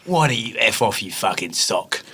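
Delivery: Ray Winstone-style insult. Pretend. Brit insulting a friend on request, mock coarse voice. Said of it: "Insult me by calling me a sock".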